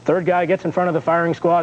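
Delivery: ordinary talk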